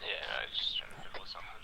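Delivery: whispering. Real, not acted. Aside while busy in game.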